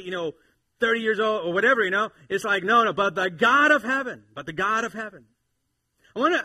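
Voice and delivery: loud, high, driving sermon delivery; full-force preaching. Real, not acted. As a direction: preach it out to the whole room.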